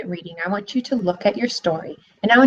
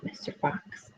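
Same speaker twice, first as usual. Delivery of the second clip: whispered aside; 16 dB quieter, slower than usual. A narrator's reading. Piglet's sly aside.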